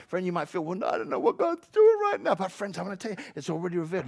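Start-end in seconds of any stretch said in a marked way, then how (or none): 0.6-2.3 high, put-on doubter's voice; doubting believer. Preacher voicing a friend who doubts God, high mock worry. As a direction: pitch it up as a mock worrier.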